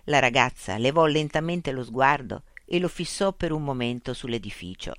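ordinary delivery, nothing marked

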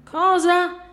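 high, loud exclamation; shocked question. Acted. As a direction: react with startled disbelief. Outraged reaction to his demand. (domanda stupita e acuta)

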